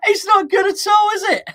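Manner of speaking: high-pitched laughing shout; laughing high squeal. Real, not acted.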